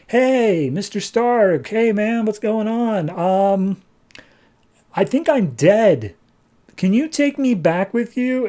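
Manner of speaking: put-on a high, eager kid pleading to be brought back; babysitter voice.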